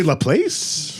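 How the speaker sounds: "La Place" said lah PLAYSS; outsider pronunciation. Shown as a demonstration. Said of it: "some people might say".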